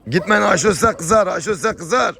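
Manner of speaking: high, loud warning call. Real, not acted. Vlogger warns animals that Ayşo will be cross, high loud call.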